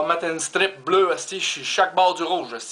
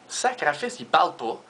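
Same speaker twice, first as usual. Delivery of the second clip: ordinary delivery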